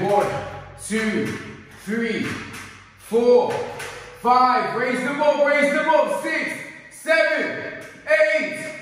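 high, loud rep counting; coach counting reps. Real, not acted. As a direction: push them through every rep.

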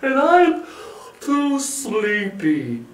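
very slow, stretched-out bear voice; big drowsy bear complaint; growling sleepy bear. A narrator's reading. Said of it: "Bear growled".

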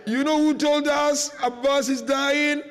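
ordinary talk

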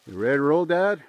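loud call across the yard. Real, not acted. Calling out to Dad before the ice dump, loud. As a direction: shout the question over to Dad.

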